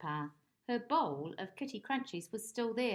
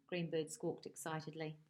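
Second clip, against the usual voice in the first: plain reading voice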